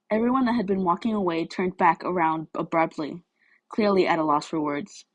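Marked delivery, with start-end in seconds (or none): none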